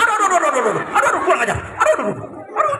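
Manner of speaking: high squealing cries of pain; monkey crying out. Acted. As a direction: yelp like a hurt little monkey. Monkey hit in face by fruit. (jeritan monyet kesakitan, suara tinggi)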